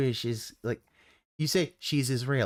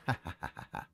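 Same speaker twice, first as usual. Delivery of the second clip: low, soft chuckle; short run of quiet ha-has; laugh; 13 semitones lower, 11 dB quieter than usual. Real, not acted. Laughing after saying they don't care, softly.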